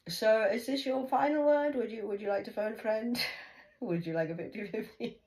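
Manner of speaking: mother mocking daughter's final word; mocking quiz host. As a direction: taunt her like a quizmaster. Mocking game show questions.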